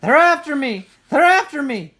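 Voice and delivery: high, very loud repeated scream; frantic screaming. A narrator's reading. Brother running to parents' room, frantic scream. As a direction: scream it twice, panicking. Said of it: "he screamed".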